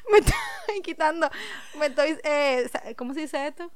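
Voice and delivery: laughing off tears, voice fading quietly; teary and laughing. Real, not acted. Teary friend answering the teasing, laughing softly. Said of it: "tú estás llorando".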